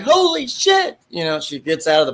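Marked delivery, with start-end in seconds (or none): 0.0-1.0 very high, astonished gasp of words; mock shock. Instructor seeing all the cadets dive in, very high mock shock. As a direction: gasp it as everyone dives in. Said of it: "She's like".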